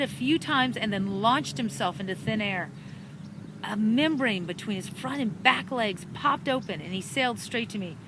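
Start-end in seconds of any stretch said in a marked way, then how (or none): none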